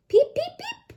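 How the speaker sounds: very high peeping; frog peeps. Acted. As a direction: peep like a tiny frog. Frog voiced in story.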